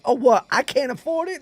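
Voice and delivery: put-on high-pitched sassy kid voice; indignant kids. Sixth graders answering teacher about phones, high indignant mimicry. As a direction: throw it back like an offended child.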